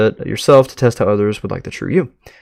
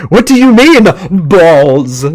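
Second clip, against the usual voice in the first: baffled outburst; 7 semitones higher, 8 dB louder, slower than usual. Acted.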